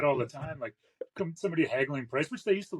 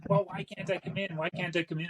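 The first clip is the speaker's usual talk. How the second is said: whiny customer; 7 semitones higher than usual. Retold or imitated. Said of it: "answering the same question".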